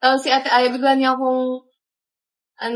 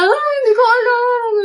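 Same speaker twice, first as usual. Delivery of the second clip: imitated crying; 9 semitones higher, 4 dB louder than usual. Friend answers while crying.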